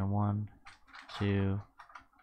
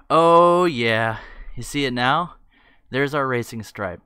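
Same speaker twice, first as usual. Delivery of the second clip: excited; 2 semitones higher, 10 dB louder than usual. Real, not acted. Excited reveal.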